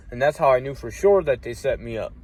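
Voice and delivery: normal talking voice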